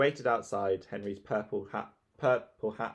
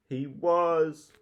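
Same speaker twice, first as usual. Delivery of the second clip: wailing; 6 semitones higher, 4 dB louder than usual. Acted. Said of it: "wailed Peter".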